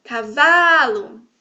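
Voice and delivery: "cavalo" called loud and slow, VA held long; loud, stretched middle syllable in "cavalo"; calling out. Shown as a demonstration. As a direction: call out "cavalo" with a big "va". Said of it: "Se eu vou chamar".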